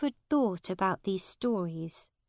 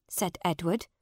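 ordinary delivery

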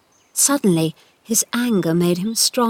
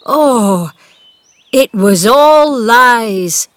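loud, slow, emotional cry; drawn-out overcome exclamation; tearful, moved; 3 semitones higher, 8 dB louder, much slower than usual. A narrator's reading. Gardener seeing the boy stand, moved to tears. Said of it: "tears ran down his cheeks".

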